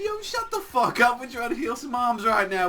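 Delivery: sudden high, loud outburst; angry snap. Retold or imitated. Teammate telling the others to shut up, angry high snap. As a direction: cut him off hard.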